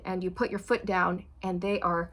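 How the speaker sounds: ordinary talk, nothing marked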